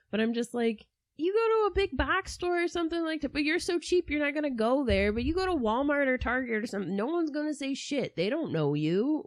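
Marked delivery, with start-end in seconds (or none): 1.2-8.1 exasperated lecturing. Retold or imitated. Exasperated advice to Castro.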